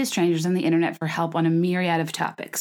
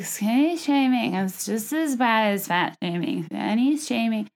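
slow, drawn-out slurred mimicry; imitated drunken slurring; 3 semitones higher than usual. Mimicking a woman's rant from her post, slurring. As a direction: slur it as one long run of mush. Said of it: "slurring and making no sense".

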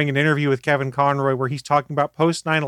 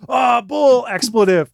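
loud, high disbelieving shout; New York heckler; 9 semitones higher, 7 dB louder, slower than usual. Retold or imitated. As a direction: heckle like a skeptical New Yorker. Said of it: "it's New York, so someone chimes".

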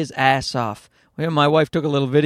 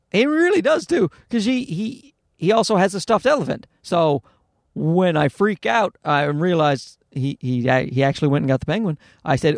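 ordinary talk, nothing marked

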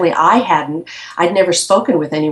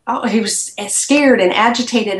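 ordinary talk, nothing marked

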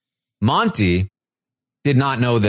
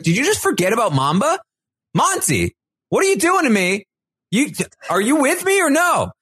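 high, rising incredulous demand; mock exasperated confrontation. [name] demands her ally's loyalty, exasperated. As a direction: imitate someone losing patience with a partner.

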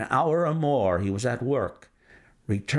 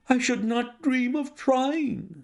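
higher, slower voice for the horrified banker; distressed banker; 12 semitones higher, slower than usual. Acted. The banker refusing Holmes in horror, higher and slow. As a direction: recoil from the idea, aghast and pleading.

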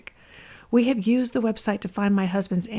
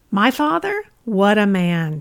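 put-on a raised, exclaiming New York accent; thick New York accent; 5 dB louder than usual. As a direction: belt it out like a proud New York grandma. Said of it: "she exclaimed in her thick New York accent".

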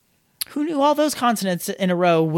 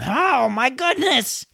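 real exasperation, higher and drawn out; exasperated outburst; 5 semitones higher, much slower than usual. Real, not acted. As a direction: draw it out slowly, fed up with your own flub. Exasperated at flubbing the take.

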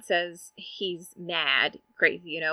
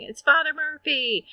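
much higher acted cry of alarm; urgent alarm; 10 semitones higher, 4 dB louder than usual. Nurse running up to the doctor, urgent alarm. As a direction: imitate a nurse raising the alarm.

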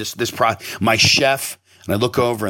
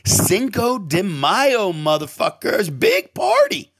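high, drawn-out whoop of words; hyped party cry; 11 semitones higher, much slower than usual. Retold or imitated. Owner retelling his pitch to staff, high hyped cheer. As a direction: stretch it out like a mock party announcement.

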